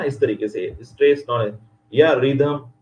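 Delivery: ordinary talk, nothing marked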